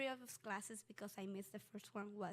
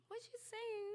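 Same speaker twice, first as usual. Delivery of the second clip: high-pitched, puzzled inner voice; acted-out confusion, pitched way up; baffled; 12 semitones higher than usual. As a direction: wonder in a high, bewildered voice. Voicing own confusion as new student.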